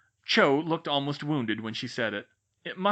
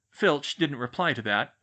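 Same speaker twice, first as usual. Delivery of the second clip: plain reading voice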